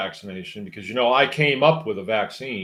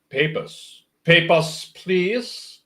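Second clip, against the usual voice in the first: mock German officer accent, higher and louder, drawling Papers; German movie officer; 7 semitones higher, 5 dB louder, much slower than usual. German officer in war movies demanding papers, mock German accent. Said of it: "kind of a German drawl".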